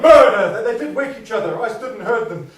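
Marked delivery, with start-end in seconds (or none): 0.0-0.5 loud retold cry of murder; cried murder. Husband retelling a sleeper's cry, loud. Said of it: "one cried".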